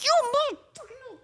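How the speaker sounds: high, fast angry outburst; furious cussing. Retold or imitated. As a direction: cuss the cat out, furious. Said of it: "I was so mad".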